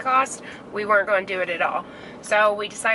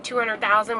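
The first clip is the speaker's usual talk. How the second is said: normal talking voice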